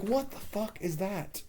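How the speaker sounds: high, drawn-out incredulous reaction; baffled disbelief. Retold or imitated. Reviewer reacting to an absurd plot twist, baffled disbelief. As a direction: play up slow, high-pitched bafflement.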